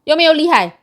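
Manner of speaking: sudden loud yelps from the heat; loud shout. Real, not acted. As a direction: shout it out, burning mouth.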